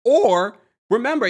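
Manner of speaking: ordinary talk, nothing marked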